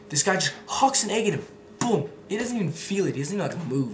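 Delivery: excited. Real, not acted. Telling about egg thrower, excited storytelling. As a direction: tell it with rising excitement.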